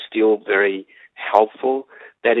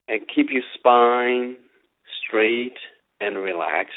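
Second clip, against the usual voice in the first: guided meditation; slower than usual. Acted. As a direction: lead them slowly into stillness. Leads a meditation.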